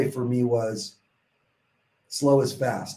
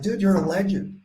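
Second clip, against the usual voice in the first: higher, excited gush at a legend; starstruck admiration; 7 semitones higher than usual. Retold or imitated. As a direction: sound amazed to meet him. Meeting a ten-time finisher.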